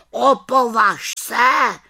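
high, loud, slow shout; warning shout. A narrator's reading. Raven shouts at the witch not to dare do magic. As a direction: forbid it with a sharp shout.

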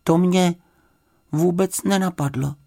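high, sheepish admission in pieces; mildly downcast little bear; crestfallen. A narrator's reading. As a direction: admit it meekly with pauses, pitched high. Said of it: "řekl Paddington schlíple".

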